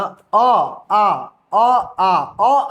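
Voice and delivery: अ and आ sounded one after another, आ held longer; vowel demo. Shown as a demonstration. Teacher sounding out अ and आ for the class. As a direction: model the vowels अ and आ from the throat. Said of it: "अ और आ का जो उच्चारण होता है ना".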